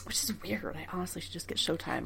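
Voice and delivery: whispered aside to herself; whispering. Real, not acted. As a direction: drop to a whisper.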